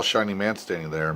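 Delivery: ordinary delivery, nothing marked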